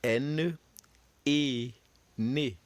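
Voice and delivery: N, A, "na" spelled then said as a syllable; French syllable drill. Shown as a demonstration. Teacher models French syllables for students to repeat. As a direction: sound out N, A and then "na" slowly for the class. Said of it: "Repeat after me".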